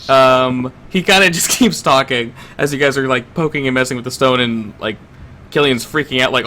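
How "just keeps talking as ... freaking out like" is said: usual speaking voice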